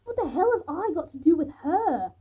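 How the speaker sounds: high, loud, indignant outburst; angry, incredulous protest; spat out. A narrator's reading. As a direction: spit it out in outrage. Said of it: "I spat".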